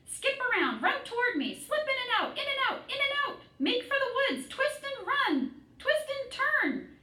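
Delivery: high, loud goose commands; crying out. A narrator's reading. Goose commanding Wilbur to dodge, high loud cry. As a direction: bark orders at the pig, way up high. Goose keeps shouting orders.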